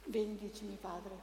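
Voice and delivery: hushed liturgical request; quiet request. Real, not acted. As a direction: murmur it humbly to the priest. Reader asks blessing at altar.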